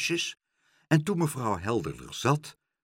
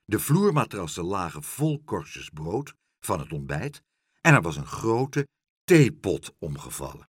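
usual speaking voice

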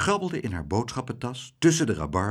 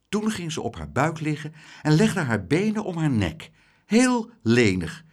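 plain reading voice

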